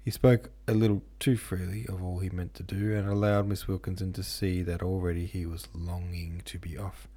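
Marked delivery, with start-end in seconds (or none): none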